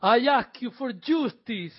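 slow, high pleading plea. Acted. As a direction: beg slowly for justice. Reading Godfather scene aloud.

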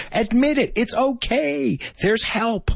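high, drawn-out coaxing; playful, high-pitched consoling; mock reassurance. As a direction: coax them like a pretend counsellor. Mock counselling of fast talkers.

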